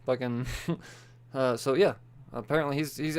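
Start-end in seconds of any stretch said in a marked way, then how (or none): none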